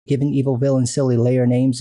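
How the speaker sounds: ordinary talk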